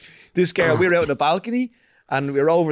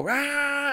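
much higher mock roar "Rah"; describing the first lap, mock engine roar; imitated engine roar; 10 semitones higher, 3 dB quieter than usual. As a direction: blast it like the cars taking off on the first lap. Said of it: "And then they all go".

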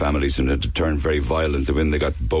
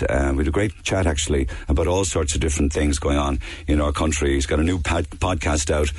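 ordinary talk, nothing marked

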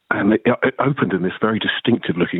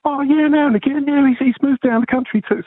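lightly mimicked London accent, high. Famous punk giving news of a friend, imitated London accent. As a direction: imitate a London mate in a raised voice. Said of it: "he had a really London accent".

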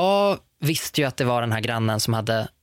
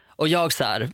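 ordinary delivery

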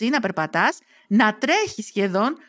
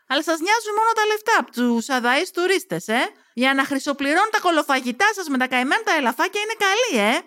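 high-pitched sarcastic protest; indignant accusation; 6 semitones higher than usual. Acted. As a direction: throw the accusation at him, voice climbing. Heroine snaps back at the manager. (αγανακτισμένη, ειρωνική διαμαρτυρία)

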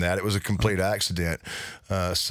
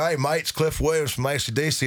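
mimicked English accent, a little higher; 4 semitones higher than usual. Bass player introducing himself by phone, imitated English accent. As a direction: put on an English accent for a caller. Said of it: "he had this English accent".

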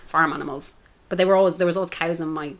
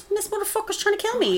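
much higher squawky chicken voice; voicing panicked chickens. Chickens panicking about the man trying to kill them, high squealing. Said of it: "They fucking were like".

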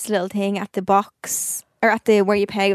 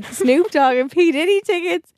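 excited news; 8 semitones higher, 4 dB louder, slower than usual. Retold or imitated. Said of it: "He's like".

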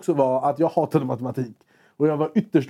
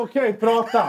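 higher, offhand dismissal; mock bored; 5 semitones higher, slower than usual. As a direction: wave him off, clearly uninterested. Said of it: "jag var ändå fett ointresserad".

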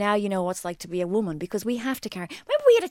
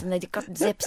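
ordinary talk

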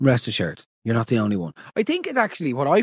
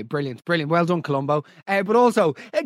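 ordinary talk, nothing marked

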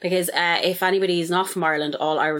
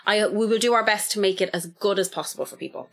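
usual speaking voice

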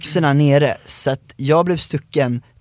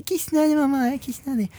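put-on high, pleading child voice, much higher; whiny little kid; 13 semitones higher, 4 dB quieter than usual. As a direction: beg mom like a desperate six-year-old. Child begging mom to pee.

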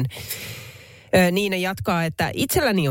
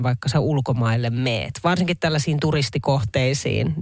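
ordinary talk, nothing marked

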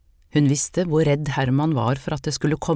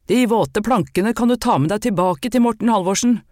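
angry shout after her, louder and higher; 5 semitones higher, 4 dB louder than usual. Acted. Furious man shouting as he chases her off. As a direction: yell it down the street at her. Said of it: "Da ropte han".

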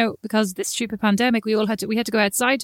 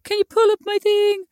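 high, pleading kiddie voice; whining small child; 11 semitones higher, 4 dB louder than usual. Retold or imitated. Mum imitating kids wanting help, high whine. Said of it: "they come out and they're like".

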